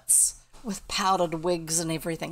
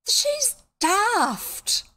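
very high, louder character outburst; acted exclamation; 6 dB louder than usual. A narrator's reading. Someone dismissing Bet's outburst, high loud exclamation.

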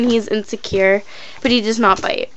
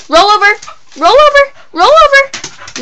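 high and louder, building up; high dog command; 11 semitones higher, 6 dB louder than usual. Real, not acted.

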